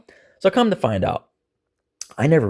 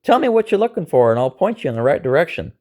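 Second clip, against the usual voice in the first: high, raised-voice challenge; retold yell; 10 semitones higher, 5 dB louder than usual. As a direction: call out to him, pitched well up. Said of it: "And I yelled at him".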